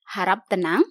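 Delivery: ordinary talk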